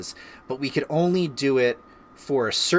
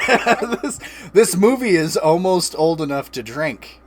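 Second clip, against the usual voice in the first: laughing; 6 semitones higher, 8 dB louder than usual. Real, not acted. Joking that Shrek is old.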